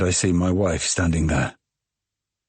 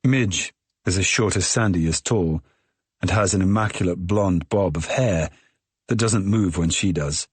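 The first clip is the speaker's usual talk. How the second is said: ordinary delivery, nothing marked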